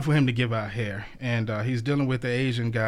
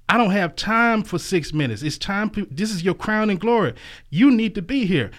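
raised, loud, impatient scolding; exasperated God; 9 semitones higher, 6 dB louder than usual. Acted. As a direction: boss who's had enough. God losing patience in joke.

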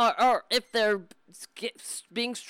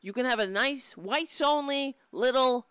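ordinary talk

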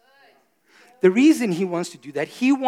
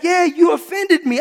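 mock insistence; 9 semitones higher, 4 dB louder than usual. Retold churchgoer insisting.

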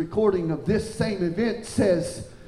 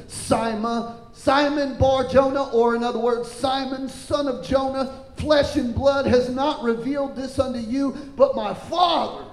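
higher, ringing preacher's delivery; raised voice, punching the key names; preaching scripture; 8 semitones higher, 4 dB louder than usual. Acted. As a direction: proclaim the verse to the congregation.

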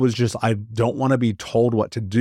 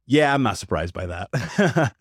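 laughing it off; 2 semitones higher, slower than usual. Retold or imitated. Retold reply laughing at news.

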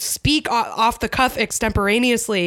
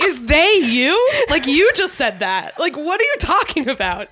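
louder, higher, baffled challenge; mock incredulous; 6 semitones higher, 4 dB louder than usual. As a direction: call him out in exaggerated disbelief. Mock challenge to quoted wrestler.

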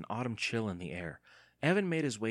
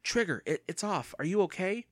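higher, urgent check on the dog; anxious, pitched-up concern; worried; 9 semitones higher, 3 dB louder than usual. A narrator's reading. Evan to his dog just freed from the collar, worried.

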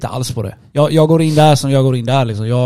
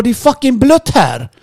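high, slow, exasperated comeback; acted-out indignant protest, drawn out; heated retort; 11 semitones higher, 4 dB louder than usual. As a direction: argue back in a raised pitch. Retold shouting match over seat.

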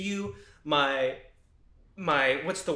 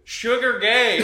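loud, high taunting shout; heckler's yell; 6 semitones higher, 10 dB louder, slower than usual. Acted.

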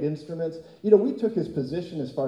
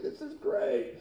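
pleased God; 11 semitones higher, 7 dB quieter, slower than usual. Acted. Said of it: "He's like".

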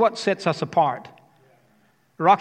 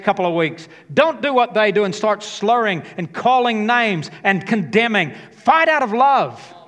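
building sermon call; 5 semitones higher, 5 dB louder than usual. Acted. Preacher rallying the congregation to fight from love, full force.

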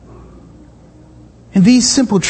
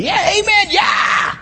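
higher amen, then a breathy yell; crowd call-back; 10 semitones higher, slower than usual. Retold or imitated. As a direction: play the crowd egging the preacher on. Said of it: "he had people going".

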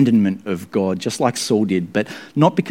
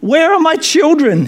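very high, loud scream; 6 dB louder than usual. A narrator's reading. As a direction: shriek for the lost children. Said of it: "he screamed".

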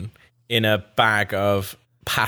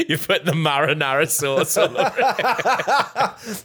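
laughing; 8 semitones higher, slower than usual. Real, not acted. Laughing at the joke.

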